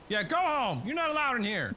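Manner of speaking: high, loud mock scolding; shooing voice. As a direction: shoo them off, high and loud. Shooing enemy in game.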